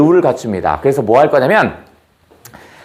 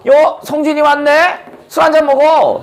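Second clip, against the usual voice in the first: put-on drunk dragon king; 11 semitones higher than usual. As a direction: press the drink on him cheerfully. Drunk king returns again.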